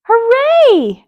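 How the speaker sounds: loud high cheer; loud, high shout of joy. Acted. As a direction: cheer like a crowd of happy pets. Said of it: "everyone shouted".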